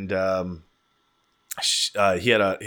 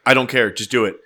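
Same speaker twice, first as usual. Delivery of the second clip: louder, insistent mock order; just snap at them to do it; impatient demand; 8 dB louder than usual. Wife ordering the roommate to go ahead, loud and impatient.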